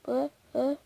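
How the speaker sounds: usual speaking voice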